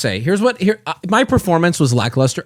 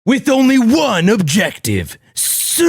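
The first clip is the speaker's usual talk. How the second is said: hammed-up trailer voice; 2 semitones higher than usual. Re-recording trailer audio, hammed-up announcer voice. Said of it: "I can't do the accent".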